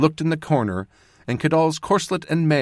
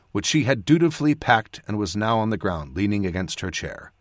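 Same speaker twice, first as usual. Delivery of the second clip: usual speaking voice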